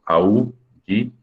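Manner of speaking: "aullido" slowly split as au, lli, do; slow teacher's breakdown of "aullido"; syllable by syllable. Shown as a demonstration. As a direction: keep au joined, pause between syllables of "aullido". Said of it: "aullido va junto".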